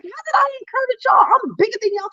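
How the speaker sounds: high, quick baffled question; high-pitched retold puzzlement; puzzled disbelief. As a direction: ask it truly baffled. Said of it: "scratching my head like".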